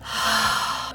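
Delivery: long unvoiced sigh of release; big relieved sigh. Shown as a demonstration. Vlogger showing the sigh after a good cry, relieved exhale. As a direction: let out a big ol' sigh after crying. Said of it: "you just release this big ol' sigh".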